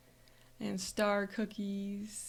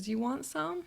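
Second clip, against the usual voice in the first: higher, lilting offer; mom offering; 6 semitones higher than usual. Retold or imitated. Mom offering food to her excited child, lilting offer. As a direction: lightly voice a coaxing mom, pitched up. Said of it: "And she goes".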